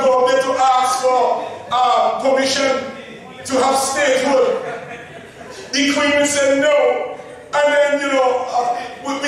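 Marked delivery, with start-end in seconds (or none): none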